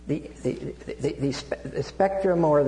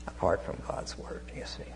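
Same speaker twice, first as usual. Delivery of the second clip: hushed whisper; 9 semitones lower, 12 dB quieter than usual. Real, not acted. Hushed aside in sermon.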